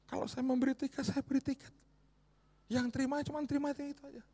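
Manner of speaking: high, loud, rising sermon climax; preaching at full force. Acted. Preacher drives point home.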